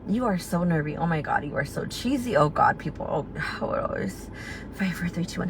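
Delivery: soft, breathy pep talk to herself; nervous self-talk. Retold or imitated. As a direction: psych yourself up under your breath. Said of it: "I told myself".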